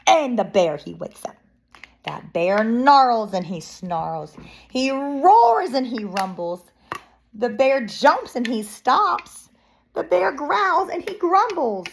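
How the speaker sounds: story reading climbing in pitch; dramatic narration. Acted. Reading children the bear's waking rage, dramatic. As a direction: build each line as the bear gets angrier.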